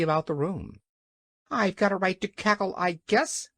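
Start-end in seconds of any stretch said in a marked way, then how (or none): 1.5-3.5 high, quick hen voice; defiant hen. A narrator's reading. Hen answers the angry King, defiant. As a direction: pipe up quickly as a cheeky hen. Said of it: "replied Billina".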